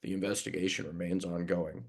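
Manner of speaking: quiet, droning official read; mock the boring press-release tone; a touch of deadpan police-statement drone; dull statement voice. Shown as a demonstration. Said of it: "God, that is so dull".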